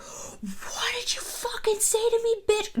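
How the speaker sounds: high, unvoiced, slow snarled whisper; hissed drunken threat. Drunk celebrity snarls at a stranger's wife, hissing. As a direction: hiss it like a wasted guy losing it. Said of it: "He's like".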